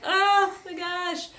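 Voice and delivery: imitated pained exclamation; retold contraction pain. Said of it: "I was having contraction".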